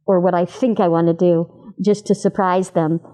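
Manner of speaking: ordinary talk, nothing marked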